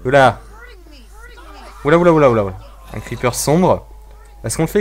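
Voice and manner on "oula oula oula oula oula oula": startled oula. Real, not acted. Surpris par un creeper.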